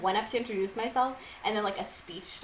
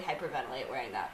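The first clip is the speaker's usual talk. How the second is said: quiet quick aside; 9 semitones lower, 8 dB quieter, much faster than usual. Retold or imitated. Retold thought about tight mask.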